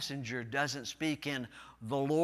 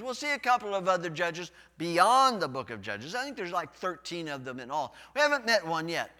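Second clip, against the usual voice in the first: louder pulpit delivery; energetic preaching; 4 semitones higher, 8 dB louder than usual. Real, not acted. Pastor preaching the Judges intro with force. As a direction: push it out to the pews.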